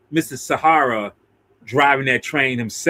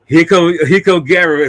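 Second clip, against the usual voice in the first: ordinary delivery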